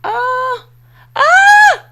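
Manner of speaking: high, loud squeal of delight; excited scream. Real, not acted. Streamer thanks a donor with a loud high squeal. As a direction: shriek with joy. Reacting to a donation.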